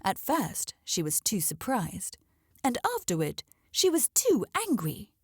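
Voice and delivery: ordinary delivery, nothing marked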